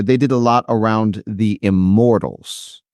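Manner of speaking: measured, distinct delivery; repeated for clarity. Real, not acted. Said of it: "I'm having trouble hearing you".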